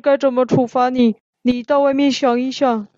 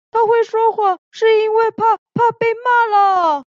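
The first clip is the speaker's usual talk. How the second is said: urgent pleading; 7 semitones higher than usual. Acted. Haha pleads for Xixi.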